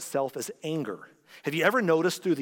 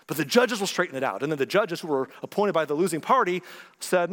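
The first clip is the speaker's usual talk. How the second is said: ordinary talk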